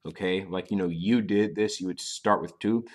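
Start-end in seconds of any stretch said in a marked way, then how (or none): none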